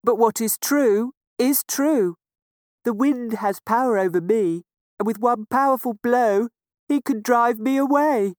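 lightly voiced, resigned rain. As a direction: admit defeat with a sigh. Rain explains the wind.

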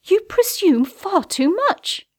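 high, loud, slow indignant rebuke; suppressed anger breaking out. A narrator's reading. Woman rebuking a presumptuous suitor, trembling with anger. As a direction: draw it out in high outrage. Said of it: "voice trembling with barely suppressed anger".

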